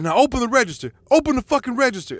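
high-pitched, urgent angry order; retold hyped-up demand. Husband demanding cash at the salon, retold hyped-up demand. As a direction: re-enact an amped-up robber-like command, speeding up. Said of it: "I just got so hyped up".

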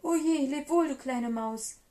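loud, high snake in a fright; storybook dismay, called loudly; alarmed calling out. A narrator's reading. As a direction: cry out a hasty farewell. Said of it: "rief sie".

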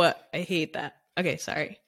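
quiet, collecting yourself after tears; soft apology through welling emotion; choked up. Real, not acted. Apologizing for crying mid-story, quietly choked up. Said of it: "I'm gonna cry".

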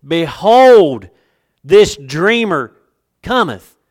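very loud, drawn-out bitter "Behold"; slow, booming bitterness with heavy pauses. Acted. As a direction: proclaim it with bitter scorn, word by word. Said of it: "hear the bitterness in their voice".

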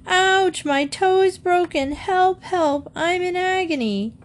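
high, frantic cries of pain; howling in pain. A narrator's reading. Boy with a hurt toe calls for help, high frantic wail.

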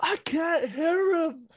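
high, slow mock confusion; mock squeaky confused voice. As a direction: drag it out high and puzzled. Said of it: "repeats the Frankfurt line".

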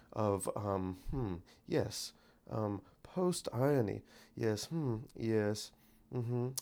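very soft, musing British radio voice; Brit on NPR. Shown as a demonstration. Getting back into character as a radio Brit, hushed mock-British musing. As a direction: hum and murmur like a pretentious British intellectual. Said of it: "Let me try to get back into character".

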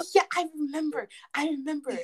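high, gasping "I remember"; excited recall. Real, not acted. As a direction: gasp and remember with delight. Gasping recall of shared theory.

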